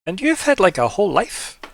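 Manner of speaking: high, emphatic character voice; NPC in character. Acted. NPC to player about their life, high animated voice.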